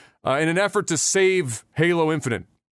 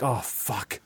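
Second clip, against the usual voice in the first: low, soft curse under the breath; quietly dismayed; dismayed realization; 6 semitones lower, 8 dB quieter than usual. Acted. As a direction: let it drop softly, regretful. Leader privately sees Joe is traumatized.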